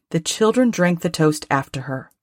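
ordinary delivery, nothing marked

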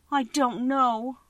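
put-on slow, sorrowful little girl. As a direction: say it slowly and sadly. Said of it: "replied Dorothy sorrowfully".

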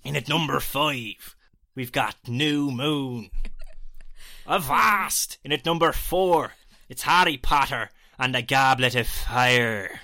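loud, slow hammed-up pirate accent; pirate voice counting down a film ranking. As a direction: growl it like an old sea captain. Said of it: "the Atlantic Seaman".

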